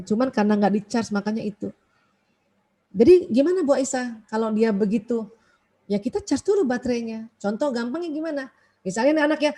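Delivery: ordinary talk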